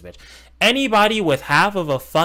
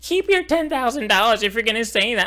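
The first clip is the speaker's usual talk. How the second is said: mocking Frogan; 9 semitones higher than usual. Retold quote of Frogan.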